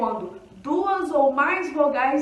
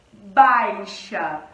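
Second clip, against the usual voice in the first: baixa stretched out and said louder for the class; slow model word; 5 semitones lower, 5 dB louder than usual. Shown as a demonstration. Teacher modeling a word slowly for students. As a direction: sound out baixa, leaning on the A. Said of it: "o encontro vocálico".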